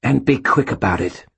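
high, harsh snarl. Acted. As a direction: snarl it impatiently. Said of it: "the gray ghost snarled".

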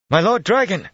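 high, loud call from a distance; shouted. A narrator's reading. A man shouts to his lord from beyond torn stonework. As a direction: shout it past a wall to be heard. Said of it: "a voice shouted from beyond".

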